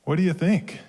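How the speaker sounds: ordinary delivery, nothing marked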